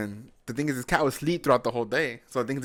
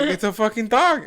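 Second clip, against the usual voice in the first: higher, louder, slower exasperated protest; wife protesting to husband, higher, louder and slowed; 11 semitones higher, 9 dB louder, slower than usual. Retold or imitated. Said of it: "She's like".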